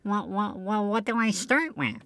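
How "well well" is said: ordinary talk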